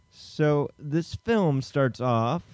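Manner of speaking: slow, drawn-out story opener; dramatic slow lead-in. Acted. Host starting the plot recap, slow and drawn out. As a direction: stretch it out like a trailer. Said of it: "Hey, [name], can you give me a".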